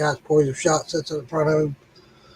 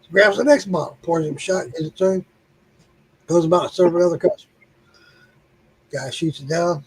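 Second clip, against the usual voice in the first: normal talking voice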